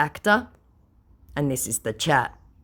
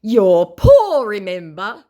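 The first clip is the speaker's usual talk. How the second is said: slow then loud, higher scornful put-on voice; mock scammer; 5 semitones higher, 8 dB louder, slower than usual. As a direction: sneer like a smug conman. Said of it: "said my scammer".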